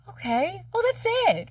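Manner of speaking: high, light relieved realization; pleasantly surprised, high-pitched relief; acted relief. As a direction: lift the voice way up as the burden drops. Acting out sudden relief.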